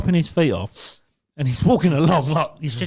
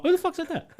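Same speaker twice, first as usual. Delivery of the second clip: mock innocent. Feigning innocence after outburst.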